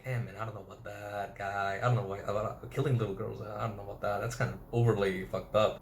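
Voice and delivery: low, hushed voice of a doubting soldier; imitated reluctant grumble, soft and low; uneasy muttering. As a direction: mumble your misgivings under your breath.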